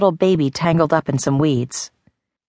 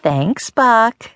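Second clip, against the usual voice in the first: teasing; 6 semitones higher than usual. A narrator's reading. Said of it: "she teased".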